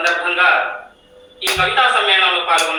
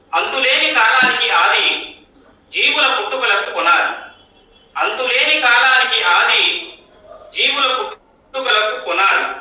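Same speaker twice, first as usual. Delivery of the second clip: higher, measured poetic declamation; poem recitation; 4 semitones higher than usual. Acted. Poet reciting a new-year Ugadi poem to the gathering, poetic recitation. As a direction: recite it line by line with a lilting rise and fall.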